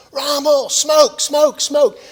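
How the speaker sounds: gunny screaming for smoke cover; urgent shout. Retold or imitated. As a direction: bellow it over the explosions. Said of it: "And then he says".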